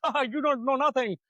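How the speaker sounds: mock electrician voice. Retelling the electrician's refusal, high and mocking. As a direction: mimic the electrician brushing him off.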